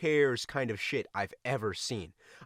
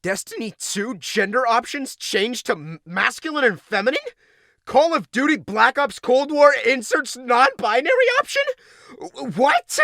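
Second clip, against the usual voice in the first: high, loud, rising disbelief; mock outrage; 11 semitones higher, 10 dB louder than usual. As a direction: read it like a scandal. Reading headlines in mock outrage.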